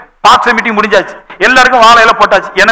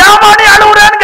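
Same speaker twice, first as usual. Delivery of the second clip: high-pitched scolding demand, re-enacted; retold shout; 10 semitones higher, 3 dB louder than usual. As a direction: cry out in exasperated rebuke, acting it. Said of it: "சத்தம் போட்டு கேட்டேன்". (சத்தம் போட்டுக் கேட்பது போல)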